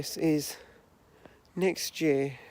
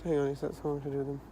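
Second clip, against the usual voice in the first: quiet; 5 dB quieter, faster than usual. Real, not acted. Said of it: "keep my voice down".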